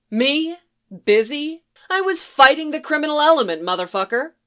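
offended woman scolding the vigilante; indignant. A narrator's reading. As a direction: fire back at the insult. Woman indignant at being called busy.

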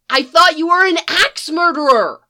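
put-on high kid voice, indignant; an eleven-year-old demanding answers; high boy's voice pushing back, offended. Boy defends himself.

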